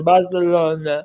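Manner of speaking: "Barcelona" very slow, groggy and lisped; "Barthelona", drawn out like someone sedated; Spanish lisp. Shown as a demonstration. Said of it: "Tá grog".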